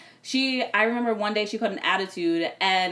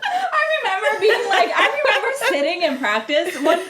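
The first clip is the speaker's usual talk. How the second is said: laughing through the words, higher and louder; 12 semitones higher, 6 dB louder, slower than usual. Real, not acted. Remembering her confused practice days, laughing. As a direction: giggle while recalling it.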